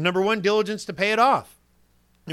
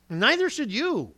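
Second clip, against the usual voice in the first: ordinary talk, nothing marked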